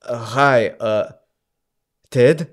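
put-on hesitant caller with an Austrian accent; halting Austrian-accented phone hello. Husband phoning home to find Ted, hesitant Austrian accent. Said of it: "I have to do this motherfucking accent".

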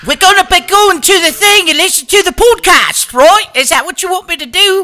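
high-pitched, hammed-up Cockney accent; mock Cockney. Podcast host balking at the accent game, mock Cockney. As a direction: squeal it out in a silly Cockney voice. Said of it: "You're gonna have me sound like".

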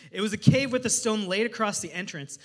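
ordinary delivery

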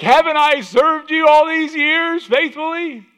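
very high, slow, loud pleading donkey voice; comic donkey pleading with its master, very high and slow; hurt talking donkey. Retold or imitated. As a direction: stretch it out like a wounded old donkey. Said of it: "and the donkey is like".

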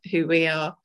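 normal talking voice

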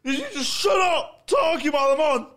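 loud angry heckle; shouting. Retold or imitated. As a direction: bark it at the crowd.

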